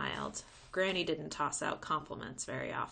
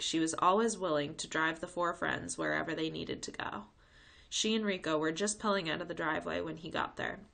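plain reading voice

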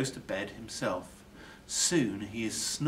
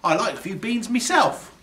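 higher, louder, pleased; cheery burglar; 10 semitones higher, 7 dB louder than usual. A narrator's reading. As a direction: say it with relish. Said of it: "says Burglar Bill".

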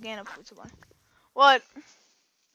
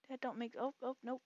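ordinary talk, nothing marked

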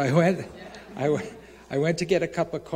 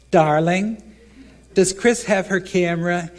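a touch louder, higher sweet phone call; lightly voiced Larry on phone; 4 semitones higher, 8 dB louder than usual. Larry phoning his wife, lightly mocked sweet tone. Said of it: "so he's like".

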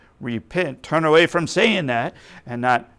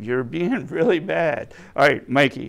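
ordinary talk

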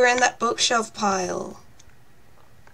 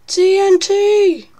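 excited shout; 7 semitones higher, 4 dB louder, much slower than usual. Real, not acted. Sudden guess in game.

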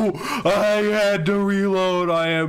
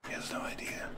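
hushed unvoiced whisper; sneaky stealth whisper; 17 dB quieter, faster than usual. Real, not acted. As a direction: breathe it so the target can't hear.

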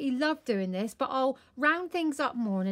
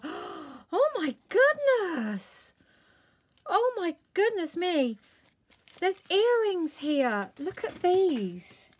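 breathless delight; 6 semitones higher, slower than usual. Real, not acted. Finding the earrings Karen sent, gasping, high and slow. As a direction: marvel at each word as you find the earrings.

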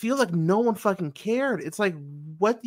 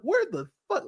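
shocked; 10 semitones higher than usual. Retold or imitated. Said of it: "I was so shocked".

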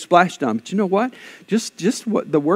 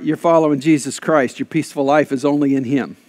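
normal talking voice

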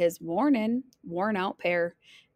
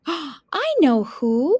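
high, loud, drawn-out realisation; bright idea; 6 semitones higher, 5 dB louder, much slower than usual. Acted. Grandma suddenly thinks of a partner, excited. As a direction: stretch it out as the idea lands.

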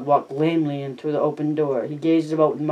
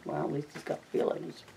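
breathed wry remark, much softer; whispered aside; 12 dB quieter than usual. Real, not acted. Reader commenting to viewers on Lenny, a wry whisper. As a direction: toss it off under the breath.

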